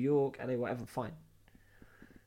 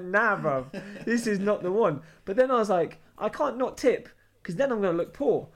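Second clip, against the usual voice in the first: louder, higher, fretting to himself; agitated inner voice; 6 semitones higher, 7 dB louder than usual. Retold or imitated. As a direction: argue with yourself, worked up. Retelling his inner dilemma.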